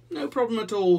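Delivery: high, loud, lightly voiced dog; dog character. A narrator's reading. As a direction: pitch it up as an obliging dog. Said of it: "the dog replied".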